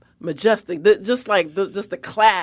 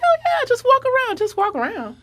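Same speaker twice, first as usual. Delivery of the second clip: mock casual staffer. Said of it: "And they're like".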